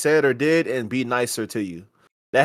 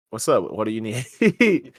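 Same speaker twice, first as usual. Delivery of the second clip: deadpan clerk reply; 4 semitones lower, 4 dB quieter, slower than usual. Retold or imitated. Clerk's flat slow reply.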